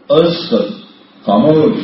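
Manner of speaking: majestic wrath. Acted. God silencing the angel of death in wrath, loud and commanding. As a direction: cut him off with full authority. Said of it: "بڑے جلال میں فرمائیں گے".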